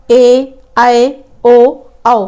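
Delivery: ए, आए, ओ, आओ each held long and slow; long vowels drawn out. Shown as a demonstration. Teacher drawing out the long vowels for pupils. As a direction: stretch every long vowel out. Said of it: "इनके उच्चारण में अधिक समय लगता है".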